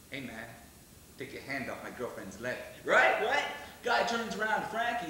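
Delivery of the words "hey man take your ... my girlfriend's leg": low, quiet, level warning; Frankie's cool threat. Retold or imitated. Frankie warns the hippie off his girlfriend, low and quiet. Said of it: "He goes like".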